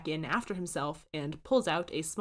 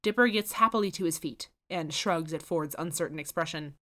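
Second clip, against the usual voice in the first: usual speaking voice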